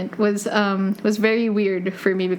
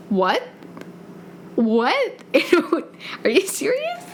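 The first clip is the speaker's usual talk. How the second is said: much higher, squealing disbelief; shocked disbelief; 8 semitones higher than usual. Retold or imitated. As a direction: squeal it like you can't believe the news. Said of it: "And I was just like".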